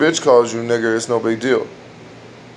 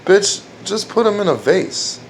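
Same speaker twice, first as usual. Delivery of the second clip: retorting; 8 semitones higher than usual. Retold or imitated. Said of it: "I said".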